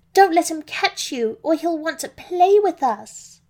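high, loud, panicked piglet shouting; squealing pig panic. Acted. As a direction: shriek the warning as a frightened little pig. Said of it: "Pam Pig let out a loud squeal".

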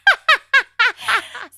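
loud helpless high laugh; real giggling fit; high burst of laughter. Real, not acted. Cohost cracking up at the long recipe, laughing. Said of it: "I'm laughing so hard".

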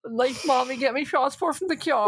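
high, pleading mock whimper. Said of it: "Orla's just like".

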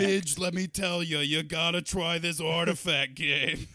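raspy Long Island grandma; old grandma voice. Pretend. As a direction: play the chain-smoking grandmother. Answers in grandma character.

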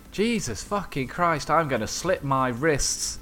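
lightly voiced exasperated stranger; retold outburst from nowhere. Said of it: "a voice from fucking nowhere went".

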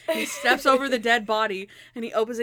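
ordinary talk

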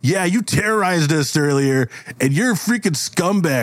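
high-pitched confrontational heckling; hostile bully. Acted. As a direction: lay into the monster, accusing. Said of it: "he actually yells at the".

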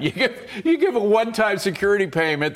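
laughing. Real, not acted. Guest laughs at rental plan.

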